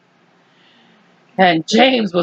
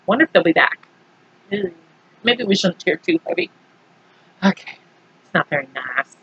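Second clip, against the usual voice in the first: softer, lower hushed doubts; quiet worry; 6 semitones lower, 6 dB quieter, much faster than usual. Acted. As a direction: murmur as if they might come back. Characters worried after cheering.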